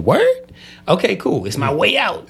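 high-pitched, re-enacted eagerness; eager acceptance. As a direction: jump at the offer, playfully acted. Reenacts his eager reply to offer.

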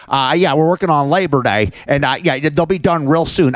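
lightly voiced cartoon rooster boss; a hint of the blustering rooster. Mocking the supervisor, lightly voiced rooster. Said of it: "I call him Foghorn Leghorn".

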